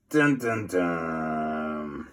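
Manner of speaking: low dramatic dun dun dun; dramatic sound effect; suspense drumroll. Shown as a demonstration. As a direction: sing out a spooky suspense cue. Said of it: "suspense sound".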